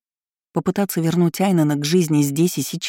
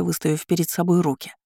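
ordinary delivery

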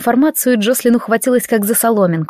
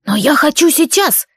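whiny bandit insisting; 6 semitones higher than usual. Acted.

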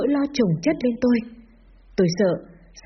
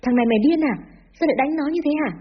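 higher-pitched grandmother's rebuke; scolding yell; 6 semitones higher, faster than usual. Acted. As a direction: scold in a raised pitch, mildly acted. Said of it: "hẩy bố ra mà quát".

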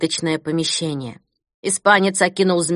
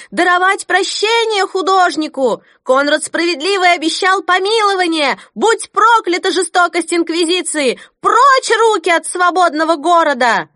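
high, loud angry women's shouts; crowd shouting; 11 semitones higher, 5 dB louder than usual. Acted. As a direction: shout at the judges in protest. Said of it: "зал взорвался от женских криков". (гневные крики толпы)

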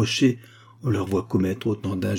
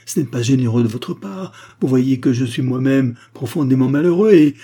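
loud, raised-pitch plea; aggrieved protest; 5 semitones higher, 7 dB louder than usual. A narrator's reading. Abogin pleading his own misery to the doctor, aggrieved. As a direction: protest emphatically, building in force.

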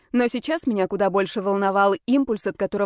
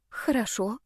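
soft, hushed agreement; whispered assent; 6 dB quieter than usual. A narrator's reading. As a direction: answer under your breath. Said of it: "прошептала Амелия".

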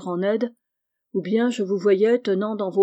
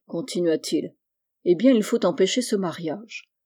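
usual speaking voice

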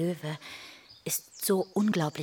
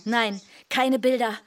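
firm refusal; 7 semitones higher, 6 dB louder than usual. Acted. Mother refuses pleading children.

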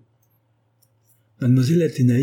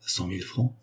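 stunned disbelief; 7 dB quieter than usual. Acted. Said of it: "qui crut avoir mal entendu".

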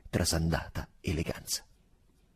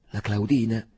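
listless; 5 semitones higher, 5 dB louder than usual. A narrator's reading. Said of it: "fece Angustina svogliatamente".

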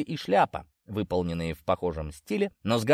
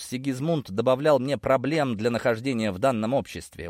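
plain reading voice

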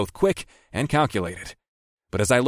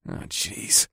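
muttered dismay; 5 dB quieter than usual. A narrator's reading. Dismayed at shadowy shapes.

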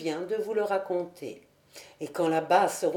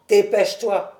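plus fort, pour presser l'enfant; expédier le gamin d'une voix forte; ordre pressant; 7 dB louder than usual. A narrator's reading. Cobus presse l'enfant de partir. (injonction vive et sonore)

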